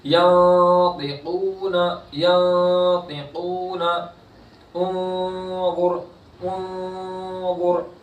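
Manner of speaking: slow, drawn-out Quranic chanting; tajweed recitation. Shown as a demonstration. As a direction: stretch the vowels in recitation. Teacher recites examples.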